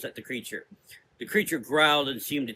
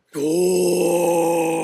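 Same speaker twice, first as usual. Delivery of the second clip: long, loud roaring bellow; monster bellow; 5 semitones higher, 9 dB louder than usual. A narrator's reading. Fire creature rampaging, loud bellow. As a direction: roar it out as a fire beast. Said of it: "it bellowed".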